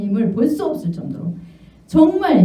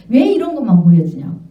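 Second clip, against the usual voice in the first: normal talking voice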